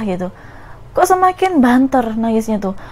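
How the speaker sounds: normal talking voice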